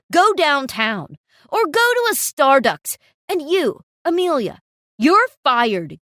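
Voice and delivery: loud, furious dismissal; angry shouting. Acted. Manager throws out the customer and fires Amelia, shouting. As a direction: throw them both out.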